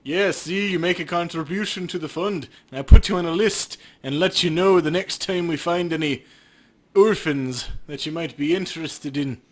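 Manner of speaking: a hint of a wheedling crooked cop; lightly voiced shady officer selling a scam; greedy cop working an angle; sleazy cop npc.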